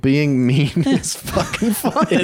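laughing through the words, slowed. Real, not acted. Comic to friends on a podcast, laughing. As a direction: chuckle it out as you say it.

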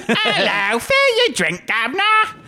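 very high, hammed-up British voice; exaggerated British impression. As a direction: overdo a cockney greeting to a governor. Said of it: "do a British impression".

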